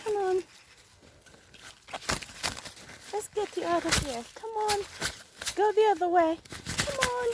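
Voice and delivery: high, soft coaxing; coaxing a wild bird. Real, not acted. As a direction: coax the bird out gently. Coaxing second pigeon free.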